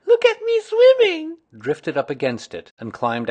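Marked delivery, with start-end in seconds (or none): 0.1-1.4 very high, loud proud squeal; tiny child bubbling with pride; Roo. A narrator's reading. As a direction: squeak it out, showing off. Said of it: "still bubbling proudly".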